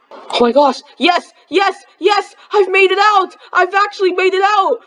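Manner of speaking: high, shouting with joy; excited shout. Acted. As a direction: yell it like you finally escaped. Escaping the house, celebrating.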